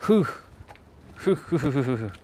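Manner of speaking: huffing in the cold; puffing breaths against the chill; shivering breaths. Real, not acted.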